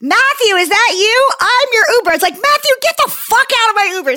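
hammed-up shout across the curb; mock calling out. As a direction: call out to a stranger in high pitch. Imitates joke second driver, then herself raging.

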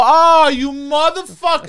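surprised outburst. Retold or imitated. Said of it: "and I go".

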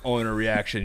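ordinary delivery

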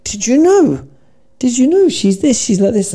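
high, gushing teacher voice; imitating the teacher. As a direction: mimic an excited nursery teacher.

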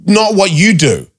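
high, mocking retort; retold sass, pitched high; sarcastic comeback. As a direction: throw the boss's words back at him. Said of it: "And I'm like".

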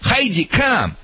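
called out high and firm; sharp call to the dog. Shown as a demonstration. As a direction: call the dog's name across the yard. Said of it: "I say".

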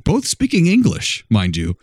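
ordinary talk

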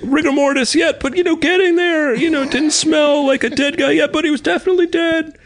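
very high imitated holler; screaming deaf neighbor. Host as deaf neighbor describing the body, high imitated scream. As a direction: keep up the deaf man's high-pitched screamed report. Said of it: "he just screams from all that tractor work".

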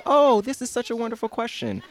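a hint of a syrupy advice-column host; sweet voice. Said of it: "like, sweet voice".

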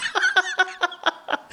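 high squealing laugh; high burst of laughter. Real, not acted. Laughing at the co-host's joke, high squeal.

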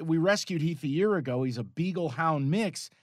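normal talking voice

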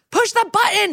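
very high-pitched mock scream; shrieked command, acted at moderate volume; retold scream. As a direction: imitate a desperate screamed order. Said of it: "he screams to Elliot".

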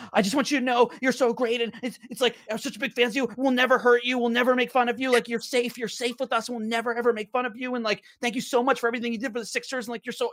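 high-pitched breathless fan babble, imitated; drunk, excited gushing. Drunk fan reassuring Sam Hinkie, gushing excitedly. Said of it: "like, a little drunk and excited".

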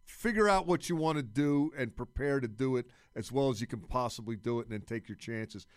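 ordinary delivery

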